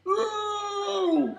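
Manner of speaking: high, drawn-out cry of shock; startled shout. Acted. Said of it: "he shouted".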